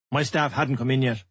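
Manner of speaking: usual speaking voice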